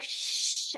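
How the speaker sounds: soft, long shush; imitated shushing. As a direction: shush like an irritated audience. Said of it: "And people are like".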